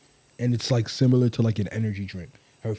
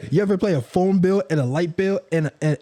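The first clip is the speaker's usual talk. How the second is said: higher, preachy parent scolding; lecturing parent; 6 semitones higher than usual. Acted.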